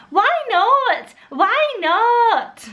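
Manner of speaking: high, bad Scottish child voice; Gruffalo's Child. Mum reading at bedtime, bad high Scottish accent. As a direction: attempt a squeaky little Scottish accent. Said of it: "the Gruffalo's Child sounds like".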